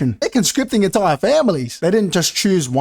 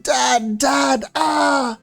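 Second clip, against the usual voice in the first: high wail calling for Dad; badly faked bereaved cry; hammed-up grieving child; mock crying; 8 semitones higher, much slower than usual. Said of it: "the wives crying".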